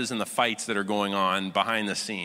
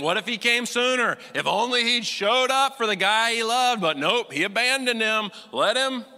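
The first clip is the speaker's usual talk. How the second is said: mocking skeptics; 7 semitones higher, 3 dB louder than usual.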